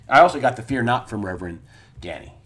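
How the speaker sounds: ordinary talk, nothing marked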